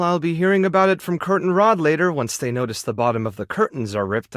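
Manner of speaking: ordinary talk, nothing marked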